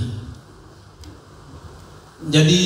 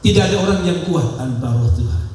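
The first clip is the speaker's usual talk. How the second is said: normal talking voice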